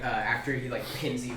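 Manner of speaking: normal talking voice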